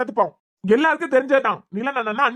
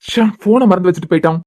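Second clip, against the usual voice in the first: annoyed loud grumble; frustrated outburst; 7 dB louder than usual. Acted. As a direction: vent out loud in irritation. Frustrated about forgotten phone.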